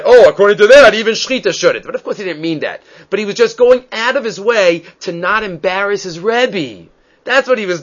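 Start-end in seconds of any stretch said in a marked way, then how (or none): 0.0-1.8 a light, mock-innocent "oh" pushed out louder, then eased off; backhanded hint. Rabbi Akiva hinting to his rebbe without embarrassing him, mildly backhanded. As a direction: slip the objection in sideways, half-joking. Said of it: "he said it in his backhanded way".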